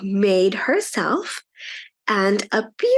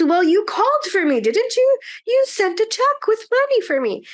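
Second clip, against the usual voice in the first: surprised grandma; 11 semitones higher than usual. A narrator's reading. Grandma surprised at Mom's shock.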